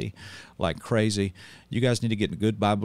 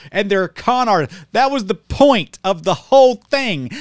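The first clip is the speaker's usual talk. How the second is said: preaching at full force, higher and louder; heated preaching; 9 semitones higher, 5 dB louder than usual. Real, not acted. Pastor warning his viewers about modernists, fiery preaching. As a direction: hammer it home like a fired-up preacher.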